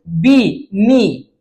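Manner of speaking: बी and नी said singly, each on a long ee; rhyme sounds shown. Shown as a demonstration. Teacher showing students the matching bee sound, sounded out. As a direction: sound out बी, नी to show the shared ee. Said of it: "बी चा उच्चार होते".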